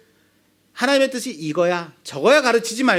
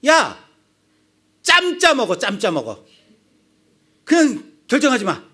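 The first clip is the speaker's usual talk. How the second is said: louder and higher, an impatient father cutting his son off; irritated dad snapping; 5 semitones higher, 4 dB louder than usual. Acted. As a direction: bark it like a fed-up dad. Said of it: "이렇게 버럭하지 않을까요?". (버럭하는 아빠)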